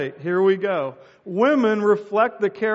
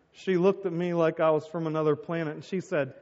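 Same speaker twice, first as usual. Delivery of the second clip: ordinary talk, nothing marked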